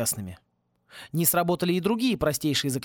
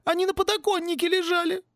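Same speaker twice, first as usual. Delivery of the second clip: much higher whiny wail; whining twins. Acted. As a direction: whimper it like scared boys. Twins whine excuses. (хнычущие мальчишки, высоко)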